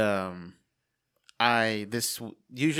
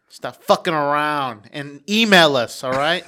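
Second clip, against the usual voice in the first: raised-voice playful telling-off; mock scolding; 5 semitones higher, 9 dB louder than usual. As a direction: scold them loudly, stretching the words.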